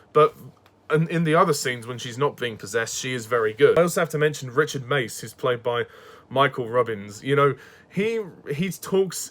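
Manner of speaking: ordinary talk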